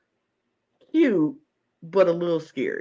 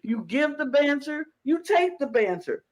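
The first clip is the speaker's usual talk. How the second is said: sassy declaration; 9 semitones higher than usual. Retold or imitated. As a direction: preach it with sass. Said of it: "And I was like".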